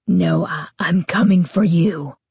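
deep gruff monster voice; gruff low voice. A narrator's reading. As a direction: growl it low and rough. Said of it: "her voice came out gruff and low".

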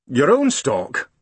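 slow, drawn-out policeman question; probing constable; london policeman. Acted. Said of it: "asked the policeman".

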